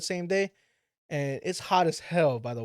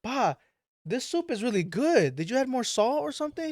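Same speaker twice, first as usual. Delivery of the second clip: higher, eager praise; excited; 8 semitones higher than usual. Retold or imitated. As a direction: sound thrilled about the soup. Praising dad's soup.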